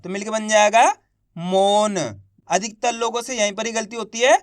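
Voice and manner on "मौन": मौन with a long held vowel and a light final न; half final letter. Shown as a demonstration. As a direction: stretch मौन, releasing only half the last letter. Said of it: "last वाले अक्षर का उच्चारण आधा किया जाता है". (मौन को खींचकर, आखिरी न आधा)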